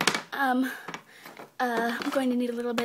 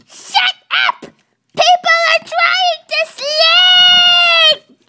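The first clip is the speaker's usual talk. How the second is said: very high, piercing shout; enraged shriek, last words drawn out; furious screaming; 13 dB louder, much slower than usual. Acted. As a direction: scream at the noisy one waking everybody. Woken sleeper screams at colt.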